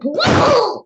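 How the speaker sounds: put-on gravelly little boy voice. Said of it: "I'm doing a little gravelly voice".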